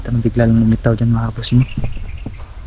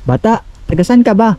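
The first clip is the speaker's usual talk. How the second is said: raised, questioning call; 9 semitones higher than usual. Real, not acted. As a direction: call out across a distance, voice raised high. Calls out to child.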